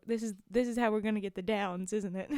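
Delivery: plain reading voice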